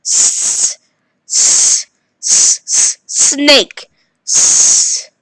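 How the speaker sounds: "s" as a long hissed s; drawn-out s like a snake. Shown as a demonstration. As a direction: hiss the "s" like a snake. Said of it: "it should sound like s".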